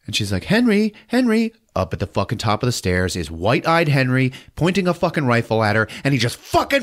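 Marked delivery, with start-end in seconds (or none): 0.4-1.5 calling out. Retold or imitated. Sarah calls up the stairs.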